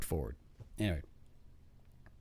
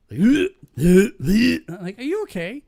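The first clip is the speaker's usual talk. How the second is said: laughing; 7 semitones higher, 10 dB louder, much slower than usual. Real, not acted. Laughing, asking the queasy Chachi.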